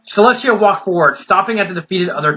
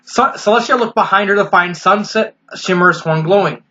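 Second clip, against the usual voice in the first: ordinary delivery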